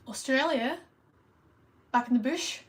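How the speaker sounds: playful attempt at Aussie speech; Australian accent. Streamer showing the few phrases they can do, Australian accent. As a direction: try out a little Australian twang. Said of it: "all I can say in an Australian accent".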